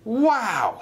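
high, amazed exclamation; excited shout. Acted. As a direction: call it out in amazement. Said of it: "shouted Oliver".

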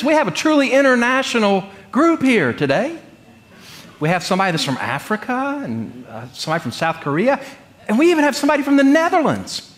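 high, bright announcer tone, mock-ceremonial; announcing conference secretary. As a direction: play the proud secretary presenting the crowd.

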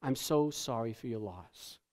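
the automatic words of a mourner at a wake; hushed condolence. Retold or imitated. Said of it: "where we say".

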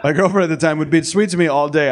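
ordinary talk, nothing marked

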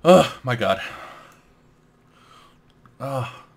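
normal talking voice

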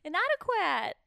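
loud, high insistence; insistent correction. Real, not acted. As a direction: call the word out to be understood. Repeats misunderstood sung word.

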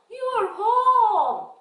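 high, loud, long-drawn greeting; mother's welcome. Acted. Mother greeting child coming in, high drawn-out lilt.

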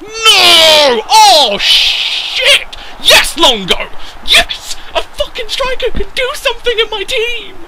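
very high screaming celebration; ecstatic disbelief. Real, not acted. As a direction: shriek as the striker finally scores. Striker finally scores.

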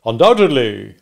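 loud, assured one-word answer; emphatic witness reply. Acted. Wopsle answers the stranger.

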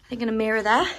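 usual speaking voice

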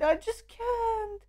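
choked up, voice catching on a cry; moved to tears while watching the video, crying softly; teary, holding back tears. Real, not acted. Fan reacting to the music video, quietly crying. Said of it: "I got teary-eyed".

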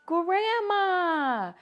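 light up as if spotting someone you love; gasping surprise. A narrator's reading. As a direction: Pete sees Grandma from the train, delighted gasp. Pete spots Grandma.

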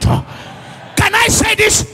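full-force preaching. Real, not acted. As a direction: cry it out to the congregation.

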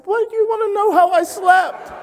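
high-pitched, affronted squeal; scandalised mock protest; indignant. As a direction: demand it in outraged high voice. Acts out indignation at friendly stranger.

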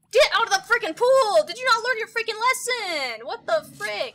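high, loud enraged shouting; furious yell. Real, not acted. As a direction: scream them out of the pool. Yelling at sim in pool.

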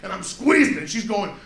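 ordinary talk, nothing marked

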